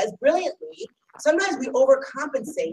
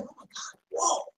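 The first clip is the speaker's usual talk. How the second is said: surprised gasp; 4 semitones higher, 8 dB quieter than usual. Shown as a demonstration. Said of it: "the rabbi's like".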